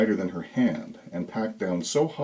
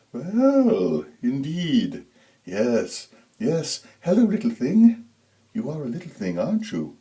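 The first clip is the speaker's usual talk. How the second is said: a creepy high falsetto ooh; eerie high ooh; 5 semitones higher than usual. A narrator's reading. Creepy Santa spotting a child, high eerie coo. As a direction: coo it high and unsettling like a hungry thing. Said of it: "His voice was a high tenor with breathy overtones".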